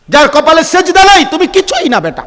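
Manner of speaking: high, loud preacher's scolding; impassioned rebuke. Real, not acted. Preacher rebuking those who never pray. (উঁচু গলায় ধমকের সুরে ওয়াজ)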